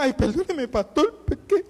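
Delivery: a crying churchgoer apologising, pitch jumping on the last word; apologetic crier. Retold or imitated. Weeping churchgoer apologises to her pastor, mock tearful. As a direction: plead sorry through tears, lightly voiced. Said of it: "empiezan... llorando".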